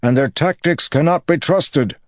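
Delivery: loud, high battle shout. Acted. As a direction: bellow it across the field.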